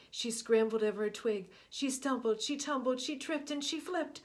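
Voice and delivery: frantic tale of her run; breathless rush. A narrator's reading. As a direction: tumble the words out.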